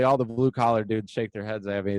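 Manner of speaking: ordinary delivery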